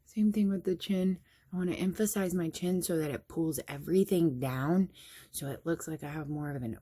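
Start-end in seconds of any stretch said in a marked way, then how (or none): none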